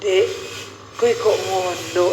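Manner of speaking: slow, low Javanese reproach; mild Javanese scolding. Retold or imitated. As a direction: imitate a husband's gentle Javanese scolding. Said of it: "Marahnya tuh".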